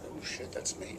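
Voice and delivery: under-the-breath whisper; whispered aside. Retold or imitated. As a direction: mutter it to yourself in disbelief. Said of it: "And I looked".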